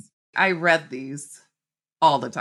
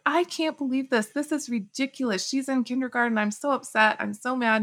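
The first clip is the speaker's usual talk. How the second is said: retold anger; 8 semitones higher than usual. Driving home, venting to husband, high indignant retelling. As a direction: replay her upset rant to her husband, pitch jumping up. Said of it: "I left that meeting so angry".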